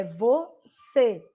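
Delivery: "você" slowly in two syllables, closed ê; closed final vowel. Shown as a demonstration. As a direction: draw out "você", narrowing the final ê. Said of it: "eu fecho mais a minha boca".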